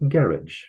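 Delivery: "garage" stressed on the first syllable, British style; British pronunciation. Shown as a demonstration. Teacher showing students the moved accent, British GARR-idge for "garage". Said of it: "in Britain, they tend to call them".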